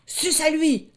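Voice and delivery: high, loud charging ram's yell; rally the flock into the charge; loud ram war cry; battle cry. Acted. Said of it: "s'écria un bélier en chargeant".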